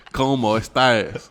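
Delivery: slow, forced country accent in Spanish. Shown as a demonstration. Showing one must try, slow country-accented Spanish. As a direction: drag the Spanish out in a country twang. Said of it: "you have to try to do that".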